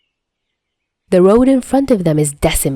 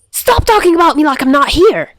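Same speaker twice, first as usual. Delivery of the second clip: high, loud indignant screech; 9 semitones higher, 4 dB louder than usual. Acted. Lemon Man screeching at the two talking about him. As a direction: shriek it, offended at being ignored. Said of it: "Lemon Man screeches".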